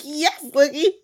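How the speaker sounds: high, loud hype; high, loud hype-girl cheer; cheering her on. Retold or imitated. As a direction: cheer her on like a proud friend. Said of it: "I'm like".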